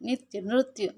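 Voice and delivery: నిత్యు with plain ని then నృత్యు with rolled ru, ^నృత్యు; contrast similar sounds. Shown as a demonstration. Teacher showing children two sounds that seem alike, careful contrast. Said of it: "కొన్ని sounds ఒకేలాగా అనిపిస్తాయి".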